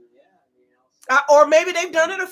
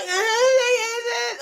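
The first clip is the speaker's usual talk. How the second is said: higher fake crying eh eh; mock whimpering; 7 semitones higher than usual. Mocking the way Ashley talks, fake whimpering. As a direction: whimper like a crybaby. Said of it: "the way she talk is".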